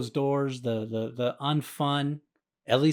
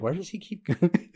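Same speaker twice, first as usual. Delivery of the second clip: imagined onlooker; 5 semitones higher than usual. Retold or imitated. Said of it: "They probably think".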